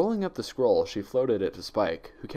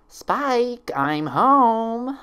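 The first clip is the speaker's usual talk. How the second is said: bright, much higher call, louder; 7 dB louder, much slower than usual. A narrator's reading. Visitor calling to Spike through the door, high and loud. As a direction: sing it out across the house on arrival. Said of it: "a familiar voice called out".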